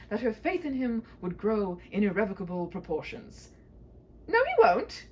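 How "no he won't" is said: loud, high denial; emphatic. Acted. Knight refusing the princess's fear, loud and emphatic. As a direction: insist firmly and loudly. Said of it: "he declared emphatically".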